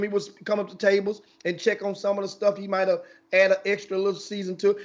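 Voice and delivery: usual speaking voice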